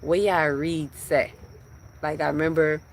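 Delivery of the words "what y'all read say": slow Jamaican patois lilt; Jamaican accent. Acted. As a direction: ask it in a drawn-out Jamaican accent. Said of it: "with my Jamaican voice".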